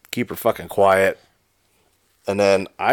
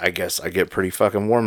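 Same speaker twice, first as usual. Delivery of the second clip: usual speaking voice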